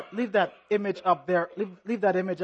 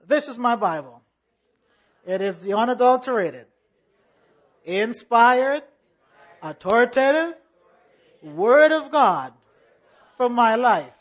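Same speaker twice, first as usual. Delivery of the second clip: church affirmation; 2 semitones higher, 5 dB louder than usual. Acted. Congregation Bible affirmation.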